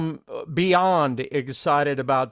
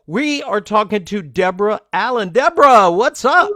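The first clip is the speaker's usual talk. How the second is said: excited announcement; 4 semitones higher, 9 dB louder than usual. Real, not acted. Host introducing guest on air.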